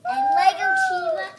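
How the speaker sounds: high, loud gleeful shout; excited cheer. Real, not acted. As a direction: cheer it out at full blast. Excited kid cheering video intro.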